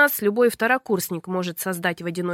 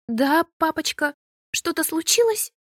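higher, sweet innocent voice; innocent daughter; 6 semitones higher than usual. A narrator's reading. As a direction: play the sweet innocent girl. Sweet daughter answers father. (высокий невинный голосок дочери)

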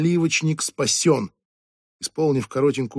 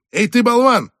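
much higher, loud insulting call; shouting after; 10 semitones higher, 7 dB louder than usual. Acted. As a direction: yell across the room at him. Boss calls after leaving waiter.

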